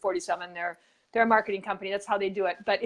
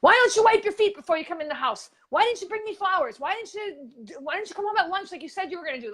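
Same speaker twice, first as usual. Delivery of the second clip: a higher-pitched, mock-scolding wife; mock nagging yell; 7 semitones higher than usual. Wife in Yiddish joke berating husband, mock yelling. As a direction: nag him in a hammed-up shrewish voice. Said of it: "just yelling".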